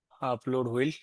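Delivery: usual speaking voice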